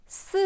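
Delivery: "Si" falling from high. Shown as a demonstration. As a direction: let "Si" drop like a firm command. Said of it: "Qui descend aussi".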